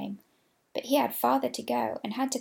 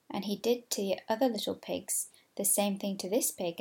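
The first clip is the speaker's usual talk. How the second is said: plain reading voice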